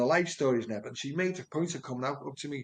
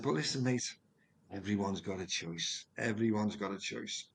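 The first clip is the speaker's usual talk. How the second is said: condescending; 4 semitones lower, 7 dB quieter than usual. Retold or imitated. Sergeant lecturing the speaker about choice, quiet condescension. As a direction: set him straight, mate to mate. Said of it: "He was patronising me".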